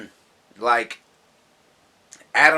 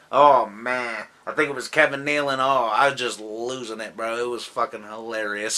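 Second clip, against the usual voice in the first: ordinary delivery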